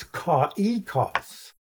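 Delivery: "kaikos" slowly, with the two vowels split, ko-EE-kos; vowels pronounced separately. Shown as a demonstration. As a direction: say "kaikos" in three syllables, o and i apart. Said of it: "pronounced separately".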